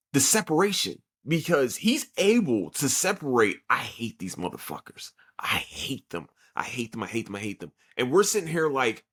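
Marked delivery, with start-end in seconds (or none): 3.7-7.7 soft, low repeated hate, fading away; a hint of a bitter loner seething to himself; quiet hatred. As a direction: mutter it, getting softer each time. Voicing Uryu's hatred for friends.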